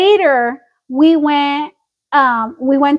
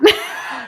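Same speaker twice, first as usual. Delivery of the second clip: high burst of laughter; 5 dB quieter than usual. Real, not acted. Reacting to her own joke.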